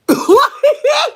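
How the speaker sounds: loud burst of high-pitched laughter; high shrieking laugh. Real, not acted. Cracking up at Randall's story.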